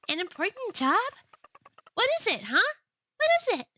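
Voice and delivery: high eager squeak; bouncing with curiosity; excited. Acted. Eager character asks.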